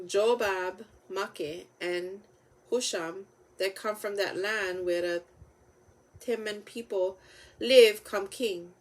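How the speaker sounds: ordinary delivery, nothing marked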